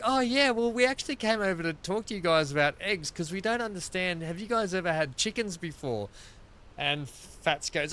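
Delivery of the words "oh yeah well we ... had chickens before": higher, lightly voiced polite neighbour; a mock innocent neighbour asking; imitating the neighbours. Neighbours asking Fats about their chickens, higher mock voice.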